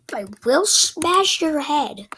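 mock threat. Threatening the character.

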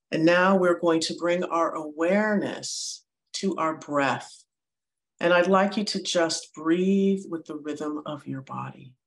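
calm meditation voice with long pauses; guided meditation. Real, not acted. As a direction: guide listeners gently into their breath, unhurried.

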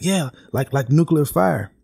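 mildly amped-up quote of an eager man; imitated excitement. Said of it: "amped up and animated".

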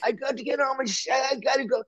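high, strained mock yelling. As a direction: imitate a drummer shrieking in panic for his things. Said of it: "screaming and shouting".

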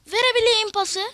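tiz ve yüksek tezahürat; golü zorlayarak coş; heyecanlı bağırış. Real, not acted. Gol için heyecanla bağırıyor. (gol fırsatında heyecanla bağırıyor)

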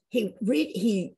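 ordinary delivery

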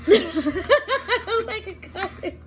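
slow, high laughing words. Real, not acted. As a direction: laugh through it, slowly. Repeats, laughing.

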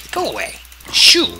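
sudden high, loud shoo; startled shout at the zombie; shooing yell. Real, not acted. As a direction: chase the zombie off with a yelp.

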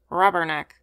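a sullen, grudging parrot squawk, lightly voiced. A narrator's reading. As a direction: mutter it back like a sulky bird. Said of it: "returned Romeo sullenly".

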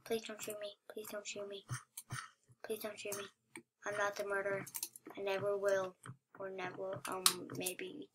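low, very soft mock begging; hushed pleading. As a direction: plead under your breath, pitched low. Pleading to avoid being shot.

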